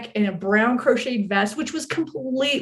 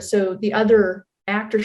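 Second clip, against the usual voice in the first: usual speaking voice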